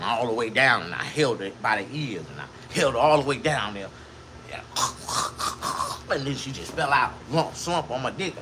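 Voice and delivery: ordinary talk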